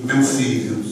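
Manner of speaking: very low, tender pastor's voice; imitating a solemn, fatherly pastor; fatherly address. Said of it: "o pastor olha pra mim e diz assim".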